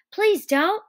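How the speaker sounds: high, loud panicked plea; frightened begging. Acted. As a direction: plead urgently for it to stop. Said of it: "he begged".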